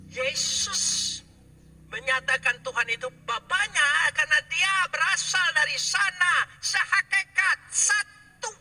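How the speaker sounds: high, loud sermon climax; forceful preaching. Real, not acted. (khotbah berapi-api, nada tinggi)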